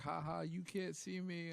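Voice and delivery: high, slow teasing sing-song; taunting. Retold or imitated. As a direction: gloat like a smug kid. Invisible cadets taunting bugs.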